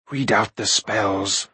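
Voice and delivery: slow, low command; measured, low-pitched order from a villain. A narrator's reading. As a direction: drag out each word of the order. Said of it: "Akron ordered".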